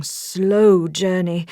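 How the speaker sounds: usual speaking voice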